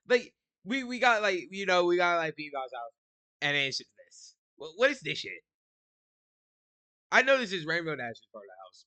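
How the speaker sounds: loud incredulous rant; excited rant. Real, not acted. Ranting at the house.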